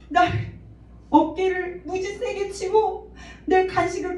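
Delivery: put-on high, childlike, tearful telling; tearful daughter. Daughter telling her mother about the bullies, high and tearful. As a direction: voice her hurt child through tears. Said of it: "그래서 막 우는 거예요".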